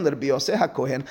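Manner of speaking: ordinary delivery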